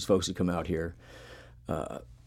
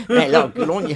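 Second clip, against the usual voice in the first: put-on laughing, loud, high Australian accent; 10 semitones higher, 11 dB louder than usual. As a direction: laugh through a broad Australian "good on ya". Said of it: "do an Australian accent".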